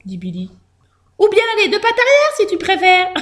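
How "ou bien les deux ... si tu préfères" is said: high-pitched mouse chirp; eager mouse. A narrator's reading. Mouse offering the bandit another pose, high and eager.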